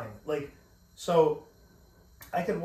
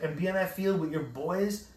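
ordinary talk, nothing marked

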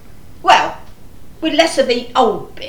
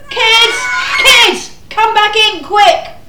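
calling out; 6 semitones higher, slower than usual. Real, not acted. Mother calls children back inside.

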